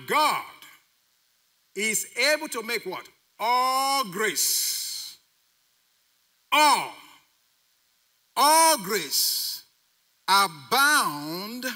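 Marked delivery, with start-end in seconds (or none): 3.4-9.6 "All" drawn out and punched hard; stress on All. Real, not acted. Preacher stressing the verse to congregation, heavy stress on "All". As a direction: lean long and heavy on "All". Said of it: "Look at the emphasis on all".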